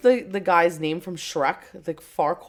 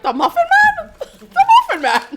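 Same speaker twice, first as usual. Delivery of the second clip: much higher squeal broken by laughter; gingerbread man impression; 8 dB louder than usual. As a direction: squeak it out while cracking up. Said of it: "And then he's like".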